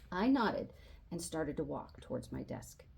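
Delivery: ordinary delivery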